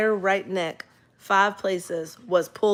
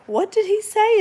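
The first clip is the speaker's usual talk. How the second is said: incredulous, much higher and louder; shocked; 14 semitones higher, 5 dB louder than usual. Retold or imitated. Chiropractor reacting to the wife's story in shock. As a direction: disbelief at what she just heard.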